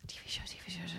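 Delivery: unvoiced whisper, thinking aloud; a private whispered search for an answer; whispering to herself. Real, not acted. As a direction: breathe the words to yourself while searching memory. Muttering to herself while thinking.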